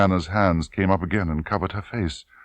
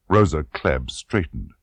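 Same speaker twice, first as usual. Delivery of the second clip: ordinary delivery, nothing marked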